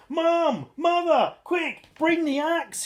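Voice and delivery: high, loud urgent shout. A narrator's reading. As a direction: yell for mum in a panic. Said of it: "he shouted".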